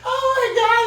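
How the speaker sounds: high excited exclamation. Real, not acted. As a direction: burst out, thrilled. Excited before the reveal.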